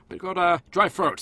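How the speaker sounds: normal talking voice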